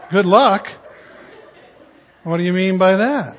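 loud, taken-aback repeat; incredulous echo. Retold or imitated. New pastor reacting to cashier's remark, loud disbelief. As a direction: throw the words back as a challenge. Echoes her words in disbelief.

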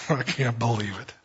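gently amused Lord; amused disbelief. Retold or imitated. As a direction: shake your head affectionately. Jesus amused head-shaking.

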